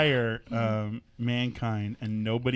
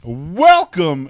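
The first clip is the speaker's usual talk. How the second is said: radio voice; 5 semitones higher, 13 dB louder than usual. Acted. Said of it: "You want me to do my radio voice".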